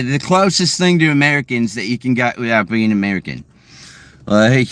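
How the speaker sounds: normal talking voice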